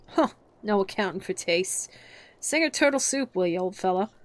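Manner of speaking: gruff and offended; huffy offended tone. Acted. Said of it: "in a rather offended tone".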